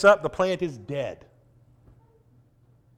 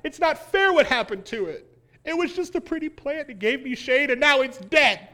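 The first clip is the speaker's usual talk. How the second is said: higher, sappy whimpering over the plant; mock pity; 7 semitones higher than usual. Preacher mocking Jonah pitying his plant, higher and whiny. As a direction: ham up a sorry-for-itself lament. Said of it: "He pities the plant".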